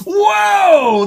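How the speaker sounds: high gasping whoa of wonder; amazed. Acted. Host marvels at the candy in the box. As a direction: gasp as if the box just opened.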